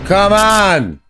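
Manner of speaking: high, frustrated shout at the game; furious outburst at the screen; angry yell. Real, not acted. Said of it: "I'm so angry".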